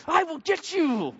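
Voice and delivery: high, slow hammed-up menace; mock threat. Driver cut off in traffic hamming up a slow high threat. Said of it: "Are you waving".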